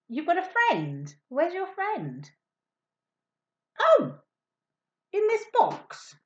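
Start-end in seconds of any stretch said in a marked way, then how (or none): 3.8-6.2 high, loud surprised oh; high, loud surprised discovery of the box. Acted. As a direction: act amazed at the box.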